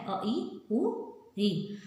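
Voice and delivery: अ, ई, उ, री spaced out very slowly one by one; slow vowel demo. Shown as a demonstration. Teacher repeating short vowels to pupils, slow and spaced. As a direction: lay out अ, ई, उ, री slowly for the class. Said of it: "बहुत ही थोड़ा सा time लगता है".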